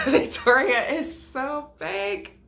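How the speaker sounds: slow, high laughing exclamation; amused, stretched-out disbelief. Real, not acted. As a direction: laugh through it, drawn out. Laughing at Victoria's fakeness.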